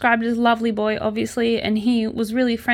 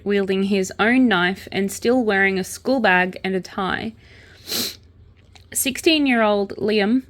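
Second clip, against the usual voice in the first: ordinary talk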